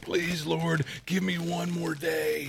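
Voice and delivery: a hammed-up plea to God; mock pleading prayer. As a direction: beg the Lord for a single day. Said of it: "you're like".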